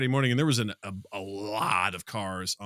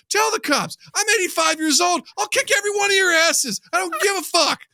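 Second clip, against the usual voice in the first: much higher, much louder defiant yell; furious old man yelling; 9 dB louder than usual. Retold or imitated. Old man defies protesters' threat, furious high yell. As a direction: bark it back, daring them. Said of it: "He goes".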